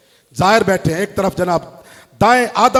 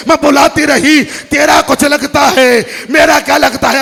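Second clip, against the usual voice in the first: loud chanted sobbing lament; weeping lament; 7 semitones higher, 11 dB louder than usual. Acted. The little girl answers her grandfather, loudly wailing. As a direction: wail it, building to a cry. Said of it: "रोकर कहते".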